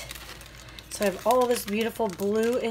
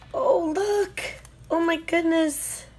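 delighted surprise; 10 semitones higher than usual. Real, not acted. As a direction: burst out in surprise. Surprised discovery.

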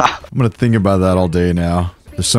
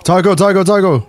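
raised, urgent calling; 8 semitones higher, 4 dB louder than usual. Real, not acted. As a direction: call a teammate across the map. Calling a teammate.

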